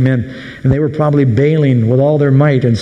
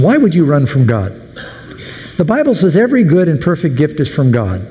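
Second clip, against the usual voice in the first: normal talking voice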